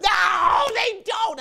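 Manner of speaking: high, loud shouted denial; kids yelling no. Retold or imitated. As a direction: yell it like the whole class.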